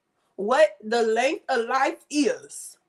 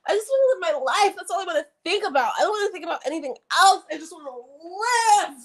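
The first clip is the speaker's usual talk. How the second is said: emotional; 7 semitones higher, faster than usual. Real, not acted. Emotional rant about freedom.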